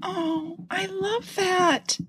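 slow, high and soft with feeling; touched. Real, not acted. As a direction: melt a little at the words. Touched by the letter.

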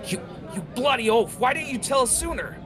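high, strained furious shout; alarmed outburst. Acted. Jackal berating Goran for hiding the beast, high and furious. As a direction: berate him in panic.

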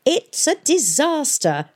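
high, slow, drawn-out lament; distressed old scholar. Acted. Arkengarth agrees with colleagues about the calamity, distressed. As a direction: play a fussy historian aghast.

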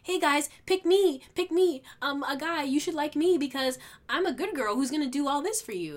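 high, loud, eager mock girly voice; squealing pick me, pick me parody; mock pick-me girl. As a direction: ham up a desperate girl begging to be chosen. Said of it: "They're like".

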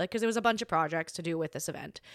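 ordinary talk

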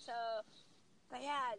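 ordinary talk, nothing marked

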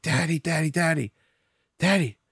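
put-on high, eager child calling Daddy over and over; a hint of an excited little girl calling out. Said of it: "she got so excited".

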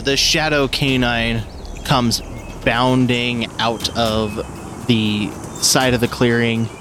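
usual speaking voice

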